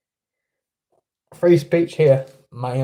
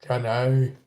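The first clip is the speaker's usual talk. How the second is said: lower-pitched Southern US impression; Forrest Gump; 3 semitones lower, 4 dB quieter than usual. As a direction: play an earnest Southern film character. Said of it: "a bit like Forrest Gump".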